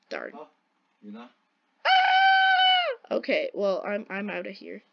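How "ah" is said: high scream. Real, not acted. Scream in game.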